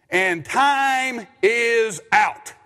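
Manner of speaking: very slow, drawn-out announcement; other announcer's call. Retold or imitated. Pastor mimics the Lincoln County announcer, very slow and drawn out. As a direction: stretch every word like a gym announcer. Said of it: "He says".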